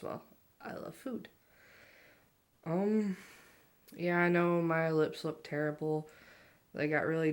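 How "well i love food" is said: breathy whispered aside. Real, not acted. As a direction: drop to a whisper for the joke. Aside about loving food.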